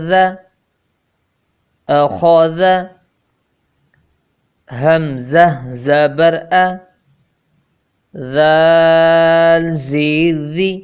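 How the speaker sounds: measured, drawn-out spelling recital; slow syllable drill. Shown as a demonstration. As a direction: spell each letter out slowly for pupils. (धीमा तहज्जी वाला पाठ)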